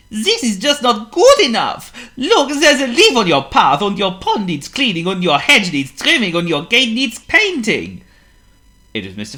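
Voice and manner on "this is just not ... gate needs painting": high, loud fussing complaint; fussy neighbour. Acted. Mister Fussy scolding Mister Calm about his garden, loud high fuss. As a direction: nitpick everything in his garden. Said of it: "a rather positive voice".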